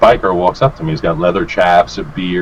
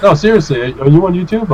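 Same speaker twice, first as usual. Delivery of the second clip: imitated insistent fan; 11 semitones higher than usual. Shop guy insists, retold.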